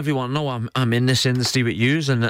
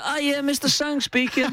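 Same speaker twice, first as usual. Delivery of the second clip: high, prim put-on voice, much higher; mock phone answer; 11 semitones higher than usual. Mocking a co-host's formal phone answer as a joke. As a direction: ham up a polite office receptionist.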